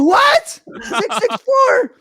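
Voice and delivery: very high, stretched-out mock shriek; re-enacted kid's panic, voice way up; panicked disbelief. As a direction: screech the numbers back in horror. Retold child's panicked reaction to reveal.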